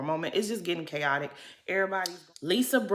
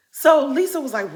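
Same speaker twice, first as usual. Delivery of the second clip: usual speaking voice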